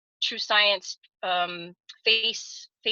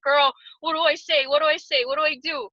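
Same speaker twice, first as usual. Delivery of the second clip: mock panicked plea; 6 semitones higher, 6 dB louder than usual. Retelling asking her friend.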